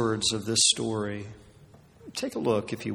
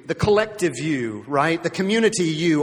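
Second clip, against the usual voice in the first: usual speaking voice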